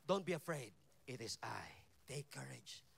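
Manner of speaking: Jesus reassures frightened disciples, low and soft; calm reassurance. Acted. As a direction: hush the frightened men gently.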